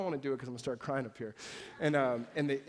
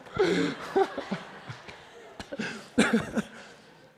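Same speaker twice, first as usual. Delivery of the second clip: unvoiced, high laughing burst; breathy laugh; 12 semitones higher, 7 dB louder than usual. Real, not acted. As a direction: laugh at your own joke. Laughs after the mock prayer.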